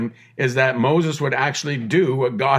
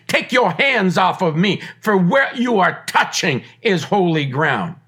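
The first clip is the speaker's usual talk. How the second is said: rising, loud preacher's build; preaching at full force; 7 semitones higher, 4 dB louder than usual. Acted. Pastor preaching holiness to listeners, full force. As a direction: preach it out, pushing each line harder.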